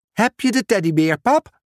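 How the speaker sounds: put-on quick, high kid's question; lightly voiced eager child. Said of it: "vroeg Julian".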